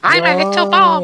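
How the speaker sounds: very high, tiny cartoon voice; little bomb voice. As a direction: squeak it as a cute little bomb.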